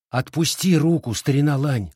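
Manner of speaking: high, urgent order; commanding. A narrator's reading. Girl takes the wounded boy's wrist, commanding. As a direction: order him firmly to obey. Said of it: "велела Фэн Хуан".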